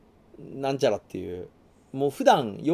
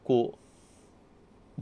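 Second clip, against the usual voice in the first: usual speaking voice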